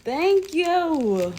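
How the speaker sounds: high, loud grateful sing-song; excited thanks. Real, not acted. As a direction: thank her with delight. Gleeful thanks.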